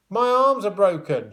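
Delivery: high, loud called reply; calling back. A narrator's reading. Amber calling back to Tom, protesting. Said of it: "she called back".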